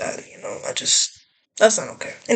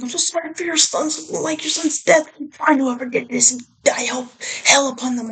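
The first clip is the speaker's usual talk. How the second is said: mock condolence texts; 5 semitones higher than usual. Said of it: "I was getting a bunch of things like".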